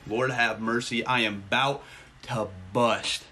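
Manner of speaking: drawn-out, low comic declaration; slow, emphatic. Pretend. Streamer overwhelmed by viewers' gifts, slow and emphatic. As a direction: space out each phrase with mock gravity.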